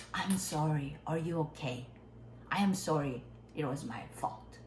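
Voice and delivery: low, soft, serious apology; mean it, no smile, settle down at the end; sincere apology. Shown as a demonstration. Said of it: "You have to mean it". (웃음 없이 낮고 진심 어린 사과)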